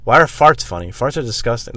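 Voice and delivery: normal talking voice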